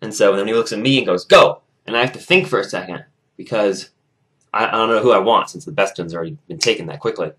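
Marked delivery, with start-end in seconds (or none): 1.2-1.6 sudden loud one-word order; barked coach command. Retold or imitated. Coach points at the other captain, shouted order. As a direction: fire it like a starting signal.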